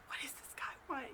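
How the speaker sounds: retold panicky whisper; freaked-out whisper. Woman behind her door as Gary knocks, freaked-out whisper. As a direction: whisper it as a secret, alarmed thought.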